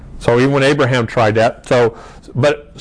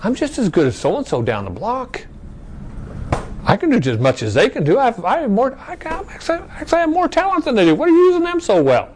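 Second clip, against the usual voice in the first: rising into a much higher, whiny brag to God; mock selfish boasting; 8 semitones higher than usual. Preacher mocking a selfish believer bragging to God, high whiny brag. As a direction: play the smug believer who thinks he's better than his neighbour. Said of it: "see how we get a little bit selfish here".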